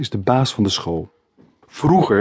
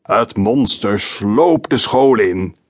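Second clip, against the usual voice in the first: slow, creaky spooky storyteller voice; teacher telling a monster tale to his class in a creaky creepy voice; creepy croaky voice; 3 dB louder, much slower than usual. A narrator's reading. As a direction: drag out each word like a lurking monster. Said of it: "vertelt hij met zijn griezelstem".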